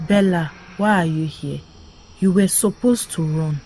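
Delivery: ordinary delivery, nothing marked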